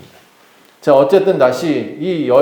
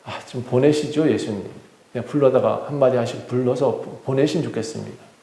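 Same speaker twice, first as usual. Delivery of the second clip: softer, low-key aside to the master; discreet request; 4 semitones lower, 7 dB quieter than usual. Acted. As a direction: quietly urge him to send someone off. Disciples quietly asking Jesus aside. (조용히 건네는 부탁)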